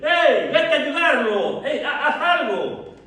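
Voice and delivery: loud, high, drawn-out yell to a bystander; full-voiced shout for help. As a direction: holler urgently at someone who won't act. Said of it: "Y los demás pastores gritándole".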